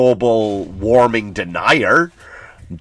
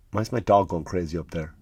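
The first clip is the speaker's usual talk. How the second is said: ordinary talk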